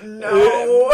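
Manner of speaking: loud, drawn-out mock groan of refusal; hammed-up exasperated cry. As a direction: refuse loudly in comic disgust. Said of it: "every time you said it, I'm like".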